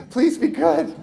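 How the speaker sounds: acted begging; pleading. Social worker pleading, repeated.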